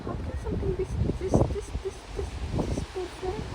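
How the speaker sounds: high, soft, awestruck murmur; awed hush. Real, not acted. Vlogger marvels at the perfect location, soft high awe. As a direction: breathe it out softly, overwhelmed by beauty.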